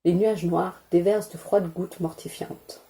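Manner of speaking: ordinary delivery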